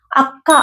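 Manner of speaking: అక్క with the క్క held before release; doubled consonant stressed. Shown as a demonstration. Teacher showing students how to say అక్క. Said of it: "ఒత్తి పలుకుతున్నాం".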